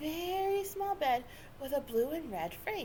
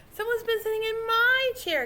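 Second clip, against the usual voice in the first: higher and louder, shocked; gasping Mama Bear; 10 semitones higher, 7 dB louder than usual. A narrator's reading. Mama Bear finds her chair used, gasping in shock. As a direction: gasp it out in alarm. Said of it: "gasped Mama Bear".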